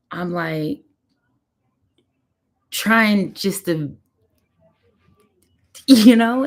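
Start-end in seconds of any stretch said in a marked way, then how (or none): 2.7-6.4 halting, then voice jumps high and loud with emotion; real choking up. Real, not acted. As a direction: fight back tears until the voice breaks. Recalls holding back tears at diagnosis.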